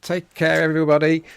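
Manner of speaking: loud cheery farewell; loud sign-off. Real, not acted. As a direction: call out goodbye to everyone.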